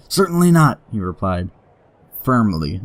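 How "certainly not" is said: firm. Shown as a demonstration. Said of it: "A key word".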